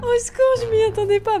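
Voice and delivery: high helpless laughter into quick words; real laughing fit. Real, not acted. Vlogger caught off guard by what she sees, real high laughing. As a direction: crack up and squeal through it. Cracks up at a surprise.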